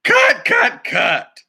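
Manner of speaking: high, loud call to cut; high shout. Acted. Duck director stopping the shoot, high shouted cut. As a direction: yell cut to stop filming.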